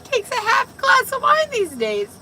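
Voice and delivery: words bubbling out through tipsy giggles; giggly tipsy. Real, not acted. Tipsy laughing after giggle fit.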